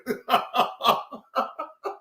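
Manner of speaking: an airy burst of laughter; breathy laugh. Real, not acted. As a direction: laugh almost without voice. Laughing at the old spat.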